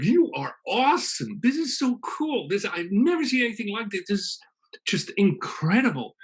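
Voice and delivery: high, gushing wonder; first human gushing praise at God, high and awed; awed adoration. Acted. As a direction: gasp it out like a dazzled child.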